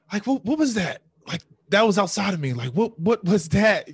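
loud, high, insistent disbelief; worked-up retold amazement; astonished. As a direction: demand to know what just happened. Retells astonishment at the experience.

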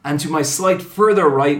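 big, loud ringmaster introduction; booming mock-epic host intro; show announcer. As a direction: proclaim it grandly, punching key words. Continues the grand introduction.

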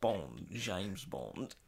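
low, soft, very slow Bond introduction; Sean Connery. Acted. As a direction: draw it out quietly like a suave spy. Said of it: "what does Sean Connery sound like".

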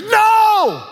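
high, drawn-out shout; shouted refusal. Acted. Preacher acting Peter rebuking Jesus, shouted refusal. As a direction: cry out in loud protest, pitch leaping up.

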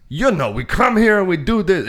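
put-on thick Russian coach accent, high and far. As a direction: quote it like a weird Russian gymnastics coach. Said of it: "it's like a Russian accent he's doing".

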